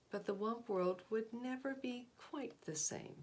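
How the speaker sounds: gentle read-aloud storytelling voice; storybook narration. A narrator's reading. Teacher finishing a picture book for her class, storybook narration.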